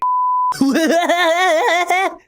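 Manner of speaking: high, loud rattling laugh; put-on character laugh. Shown as a demonstration. Voice actor testing a laugh take, exaggerated high laughter. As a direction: force out an exaggerated cartoonish laugh. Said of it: "I should have done that voice before I screamed".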